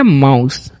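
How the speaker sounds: माउस with the ou said as आउ; माउस, ou as in ouch; correct pronunciation. Shown as a demonstration. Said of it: "तो इसे हम क्या पढ़ेंगे?".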